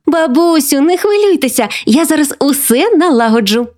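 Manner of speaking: put-on high, girlish voice full of confidence; keen granddaughter. A narrator's reading. Granddaughter promises grandma she'll fix everything, keenly. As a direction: chirp it like a capable kid.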